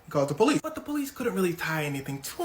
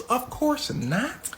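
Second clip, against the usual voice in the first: slow, drawn-out, higher dismissal; mock reassurance; 7 semitones higher, much slower than usual. Government reassures Super Cop, slow and high. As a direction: stretch it out as if obvious.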